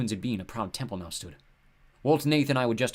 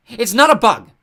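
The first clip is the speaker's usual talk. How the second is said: much louder and higher, indignant; angry shout; 12 semitones higher, 17 dB louder, slower than usual. Acted. Goddess shouting at Sadie over her symbol, furious. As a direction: bark it back at the insult. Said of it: "Nath glowered".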